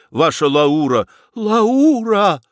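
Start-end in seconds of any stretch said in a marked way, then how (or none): 1.3-2.4 high, loud cry of amazement; exclaiming. A narrator's reading. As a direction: cry out the name in excitement. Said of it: "воскликнул Федяшев". (восторженно воскликнуть)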